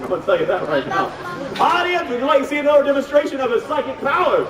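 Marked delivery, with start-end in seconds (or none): 1.6-4.5 high, projected showman's call; calling to crowd. Acted. Host offering the audience another demonstration, rousing call. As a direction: throw the question out to the whole audience.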